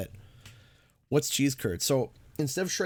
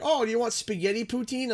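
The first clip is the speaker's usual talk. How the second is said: mock server offer; 8 semitones higher than usual. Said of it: "I remember they were like".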